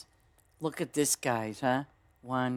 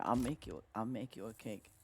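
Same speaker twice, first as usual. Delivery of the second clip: ordinary delivery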